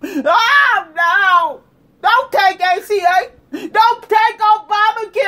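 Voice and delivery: high, loud drawn-out wails; exaggerated sobbing protest at full volume; mock crying. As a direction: bawl and plead, hammed-up. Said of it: "Now y'all crying".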